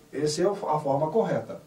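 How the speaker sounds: usual speaking voice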